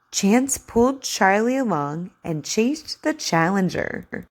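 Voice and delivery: slow, clear story reading; over enunciating. Acted. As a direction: read to a child, carefully. Reads the story aloud for practice.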